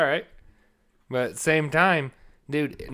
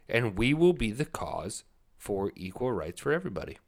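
ordinary delivery

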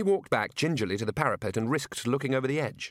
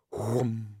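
mock whoom. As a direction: hum like a whirling bull roarer. Imitating the bull roarer noise.

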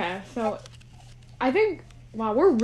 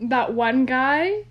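usual speaking voice

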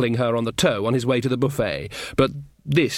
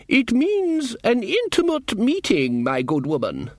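higher, slow offended duchess voice; haughty duchess; 10 semitones higher, slower than usual. Acted. Duchess prodded by Nanny Ogg, high and haughty. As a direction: play the affronted noblewoman, drawn out.

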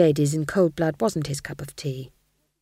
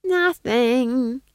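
lightly voiced witch, higher; a hint of innocence, pitched up; Nanny's character voice; 7 semitones higher, 4 dB louder than usual. A narrator's reading. Witch covering up to the Duke, lightly voiced role. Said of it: "said Nanny".

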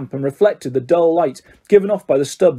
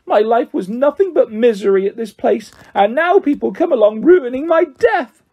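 self-pitying wail; 7 semitones higher than usual. Acted. Myrtle wails her self-pity.

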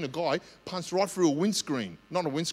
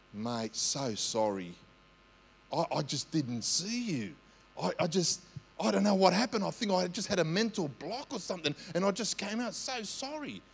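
soft apology; 3 dB quieter than usual. Retold or imitated. Preacher apologising to the angry driver at his window, soft and sheepish. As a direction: apologise gently to calm him.

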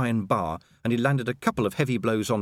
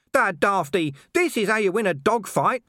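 high, louder dog voice, charging in; scruffy dog, excited; 10 semitones higher, 5 dB louder than usual. A narrator's reading. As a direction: cheer himself into the fight. Gaspode leaps into fight.

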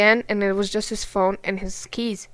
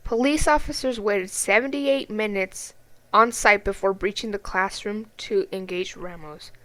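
ordinary delivery

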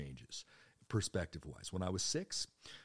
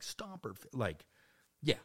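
ordinary delivery

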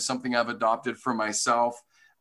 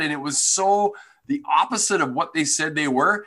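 ordinary talk, nothing marked

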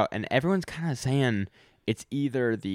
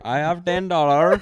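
higher, louder hammed-up Indian accent; mock Indian accent; 6 semitones higher, 5 dB louder than usual. Echoing friend's joke in a mock Indian accent. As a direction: mimic the Indian accent back. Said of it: "Indian out".

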